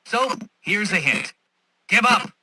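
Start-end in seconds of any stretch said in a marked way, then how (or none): 1.9-2.3 loud, high blunt order; shouted taunt. Acted. Villain giving Batman a hint, shouted taunt. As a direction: yell it as the obvious hint.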